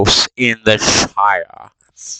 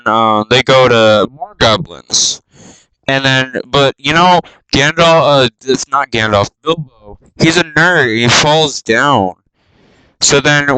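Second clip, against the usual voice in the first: normal talking voice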